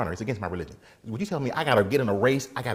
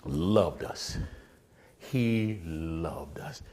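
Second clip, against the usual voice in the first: low, hushed, very slow; drawn-out emphasis; 7 semitones lower, 6 dB quieter, much slower than usual. Real, not acted. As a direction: stretch each word softly for effect. Emphasising how much he loved them.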